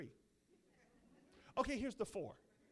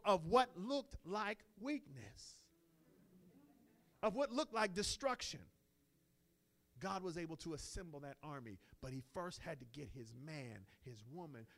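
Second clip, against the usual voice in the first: ordinary delivery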